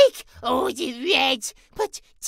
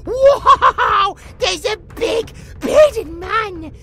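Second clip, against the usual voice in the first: awestruck excitement; 2 semitones higher, 6 dB louder, slower than usual. Acted. J J greeting a big bearded man, loud childlike awe. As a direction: gush like a thrilled little kid, drawing words out.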